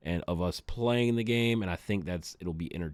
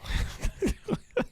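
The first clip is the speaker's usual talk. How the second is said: laugh. Real, not acted. Laughs at own blunt request.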